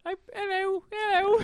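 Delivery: very high Cockney squeak, played for laughs; mock Cockney doll. Showing friends the doll is funny, mock Cockney. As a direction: chirp hello as a tiny Cockney doll. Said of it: "What you're doing right now is very creepy".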